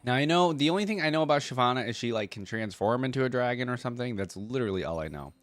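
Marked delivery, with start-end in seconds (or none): none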